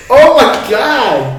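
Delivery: high, loud, drawn-out exclamation; real shock at himself, stretched out high; mortified disbelief. Real, not acted.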